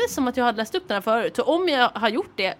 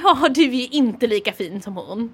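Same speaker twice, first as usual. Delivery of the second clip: ordinary delivery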